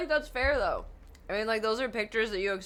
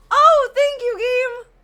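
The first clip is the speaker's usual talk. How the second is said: much higher, much louder joyful shout; ecstatic thanks; 17 dB louder, much slower than usual. Real, not acted. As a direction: yell your relief at the game. Gets lucky checkpoint.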